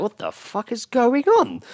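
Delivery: high, slow baffled voice; mock bewildered. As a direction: stretch out the disbelief. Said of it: "I was like".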